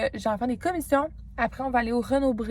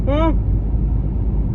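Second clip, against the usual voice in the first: big tired yawn; 5 semitones higher, 6 dB louder than usual. Real, not acted. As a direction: yawn out loud, worn out. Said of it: "je vais rebailler".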